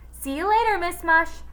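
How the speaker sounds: put-on high kid voice; boy's voice. A narrator's reading. Villain faking a boy's goodbye, high. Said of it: "said the voice of Eric Ovens".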